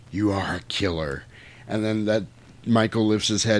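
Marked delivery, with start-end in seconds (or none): 0.1-1.2 low, soft, measured quote; understated villain verdict. Retold or imitated. David answers Michael from his perch, low and quiet. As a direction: state it quietly, with certainty. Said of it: "and he goes".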